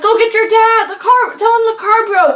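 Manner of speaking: high, loud, frantic mom in the car; panicked mom. Retold or imitated. Mom panicking after the gear shift rips out, high and loud. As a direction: sound like mom yelling that the car broke. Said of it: "my mom's freaking out".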